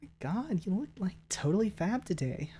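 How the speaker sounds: put-on Valley Girl voice. Complimenting someone on looking fab, soft high Valley Girl. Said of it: "Valley Girl voice would be good too".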